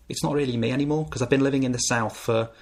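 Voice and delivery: ordinary talk, nothing marked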